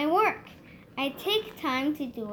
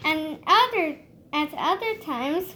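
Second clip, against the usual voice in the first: ordinary delivery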